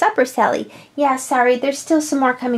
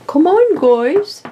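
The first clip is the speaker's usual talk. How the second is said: drawn-out, higher call; 4 semitones higher, 4 dB louder, slower than usual. Pretend. Woman calls to pets on their way, calling out. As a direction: call to the gang at a playful distance.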